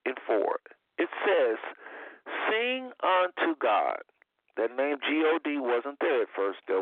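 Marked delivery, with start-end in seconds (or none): 2.5-4.0 scripture reading. Acted. Reads scripture slowly.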